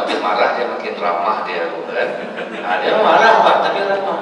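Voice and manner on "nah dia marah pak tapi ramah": high squeal of laughter through the words; laughing. Real, not acted. Preacher laughing at his own joke to the congregation, high and laughing. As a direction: laugh out the punchline. Joking about angry-yet-friendly Sundanese.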